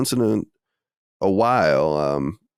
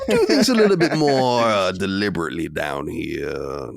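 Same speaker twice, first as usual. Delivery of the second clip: Southern Man voice. Acted. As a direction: savor it like a sly Southern gentleman.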